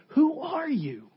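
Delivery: high-pitched, affronted challenge; stunned disbelief. Acted. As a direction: act out stunned listeners demanding an answer. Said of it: "They're completely stunned".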